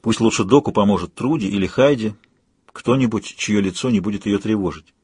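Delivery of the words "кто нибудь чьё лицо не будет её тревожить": ordinary delivery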